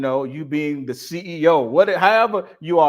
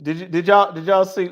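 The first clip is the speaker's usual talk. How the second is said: ordinary talk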